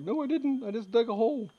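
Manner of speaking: hammed-up high denial to the caller; mock indignant protest. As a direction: squeak it out like a wrongly accused kid. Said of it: "And I'm like".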